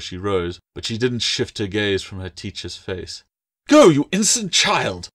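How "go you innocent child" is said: high, loud snapped dismissal; exasperated mistress losing her temper; angry snap. A narrator's reading. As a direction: snap at the child to leave. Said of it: "Blakeworthy snapped".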